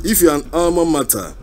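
usual speaking voice

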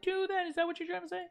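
mock offended.

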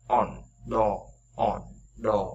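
"On" and "đo" with lips rounded on the o; rounded o demo. Shown as a demonstration. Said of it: "Âm o thì chúng ta tròn môi".

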